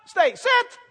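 high loud shouted command; God commanding like an urgent owner. Retold or imitated. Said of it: "He might say".

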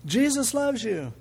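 high, slow call of Jesus loves you; lightly acted shout into a mailbox; mock yell into a mailbox. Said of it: "and he goes".

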